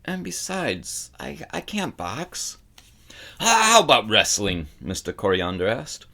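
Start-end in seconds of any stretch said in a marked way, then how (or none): 0.1-2.6 put-on soft, higher, slow timid boy. A narrator's reading. Boy admits to the bookseller, soft timid voice. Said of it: "Bastian gasped". 3.4-4.7 put-on loud, gruff growl of a grumpy old man; gruff old bookseller. A narrator's reading. Old bookseller lectures the boy, loud gruff growl.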